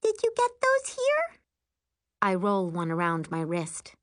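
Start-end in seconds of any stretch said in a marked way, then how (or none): 0.0-1.4 put-on very high child's question; high-pitched small-kid curiosity; curious little girl. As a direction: pipe up with sudden interest. Child suddenly curious about bracelets.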